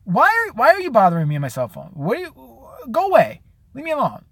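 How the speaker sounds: fed-up reply to a phone survey; mock exasperation. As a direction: whine at the caller to go away. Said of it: "And I'm like".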